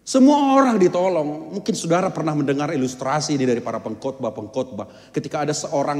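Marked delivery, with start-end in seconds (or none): 0.1-1.6 higher, louder, slow and deliberate; emphatic declaration. Real, not acted. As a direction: proclaim it word by word. (menegaskan dengan lambat dan keras)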